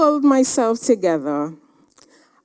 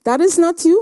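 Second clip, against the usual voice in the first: stern declaration; 9 semitones higher, 5 dB louder, faster than usual. Retold or imitated. President lecturing the speaker.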